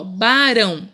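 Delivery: BARÃO slow, with a light tapped R; single tapped R. Shown as a demonstration. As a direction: stretch "barão", tapping the R once. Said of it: "Vocês viram que um R só". ("barão" devagar, com R brando)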